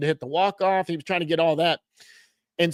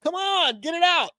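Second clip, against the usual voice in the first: impatient urging; 10 semitones higher than usual. Retold or imitated. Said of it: "I'm over his shoulder going".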